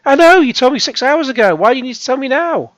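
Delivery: high, loud exasperated retort. Retold or imitated. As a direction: snap back at the app in annoyance. Talking back to the app.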